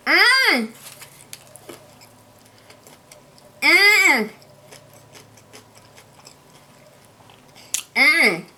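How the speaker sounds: loud smacking mmm while chewing; messy loud eating. Real, not acted. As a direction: smack and hum over a big bite. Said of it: "if you don't like smacking".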